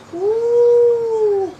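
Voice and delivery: whooping yell; high cheer. Real, not acted. Sudden cheer.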